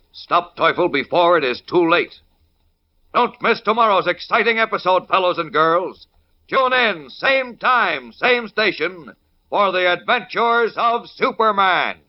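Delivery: upbeat radio promo read; announcer. Acted. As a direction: hype the next episode. Narrator's closing promo.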